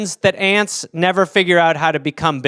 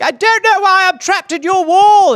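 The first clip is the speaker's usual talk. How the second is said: high, louder bewildered voice; a silly high put-on aunt; confused trapped aunt; 11 semitones higher, 4 dB louder than usual. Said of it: "she's like".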